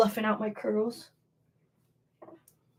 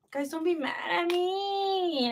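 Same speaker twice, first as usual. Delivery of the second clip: mock whimper; 7 semitones higher, 6 dB quieter, slower than usual. Mock crying plea to chat.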